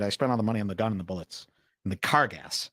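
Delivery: imitating friend. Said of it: "exactly like how my friend said it".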